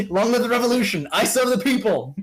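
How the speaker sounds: high, loud, hammed-up revolutionary chant; fiery revolutionary speech. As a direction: shout the slogans like a zealot. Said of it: "imagining John Galt standing right there with them".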